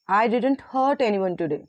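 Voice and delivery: "hurt" lifted and lengthened, "today" falling; stress on HURT. Shown as a demonstration. Teacher models highlighted word for class, stress on "hurt". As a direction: put the weight on "hurt". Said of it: "we have to stress the highlighted words".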